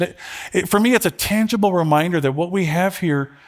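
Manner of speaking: normal talking voice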